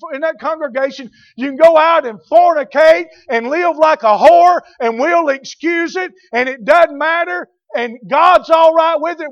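high, loud, indignant pulpit shout; fiery preacher hammering each phrase at high pitch; full-force preaching. Real, not acted. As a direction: thunder a rebuke at the whole church.

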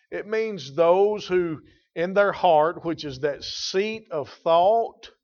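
loud, emphatic pulpit delivery; full-force preaching. Acted. Preacher driving home inner purity, loud and emphatic. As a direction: preach it out to the congregation.

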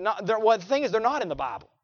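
ordinary talk

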